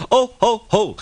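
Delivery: high, loud jeering laugh; mocking laugh. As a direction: laugh at him like rude trucks. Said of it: "said the trucks".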